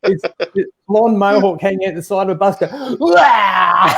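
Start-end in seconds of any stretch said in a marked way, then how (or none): 3.0-3.9 loud, high retching "wah"; hammed-up hurl out of a bus; imitated vomiting. Passenger being sick out of the bus, comic retch.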